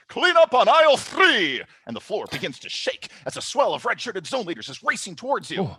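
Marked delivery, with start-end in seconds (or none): 0.1-1.6 loud, high, drawn-out store call; slow, high yell over a walkie-talkie. Acted. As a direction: shout it out like a frantic manager summoning backup. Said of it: "He calls into his walkie-talkie".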